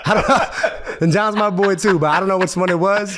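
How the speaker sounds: high-pitched, talking through laughter; laughing. Real, not acted. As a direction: protest helplessly while cracking up. Laughing about which scandal it was.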